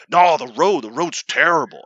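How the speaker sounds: loud, insistent grumble; exasperated dad impression. Dad insisting to son about the road, mock exasperation. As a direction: imitate a stubborn father repeating his complaint.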